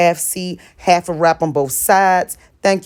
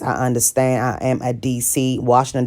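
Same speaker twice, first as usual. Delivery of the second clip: usual speaking voice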